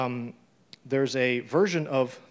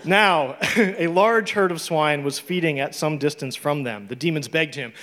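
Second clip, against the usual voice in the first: scripture reading; 4 semitones higher, 9 dB louder than usual. Acted. Reads scripture aloud.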